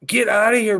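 loud playful shoo. Real, not acted. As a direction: shout it playfully. Shooing friend loudly.

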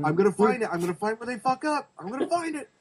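high, rising manic voice; hammed-up obsessive fan climbing in pitch; mock obsessive self. Imitating obsessive [name] hunting flaws.

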